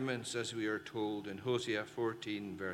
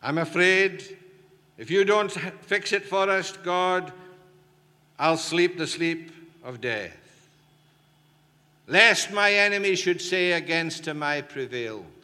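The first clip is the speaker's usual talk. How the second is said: high, loud desperate plea to God; pleading David; 7 semitones higher, 11 dB louder than usual. Acted. David arguing with God for help, loud desperate pleading. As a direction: beg God like David, loudly. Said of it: "that's what David does".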